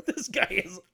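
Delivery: slow, incredulous laughing voice; mock her disbelief with a laugh; imagined date, laughing. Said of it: "she was like".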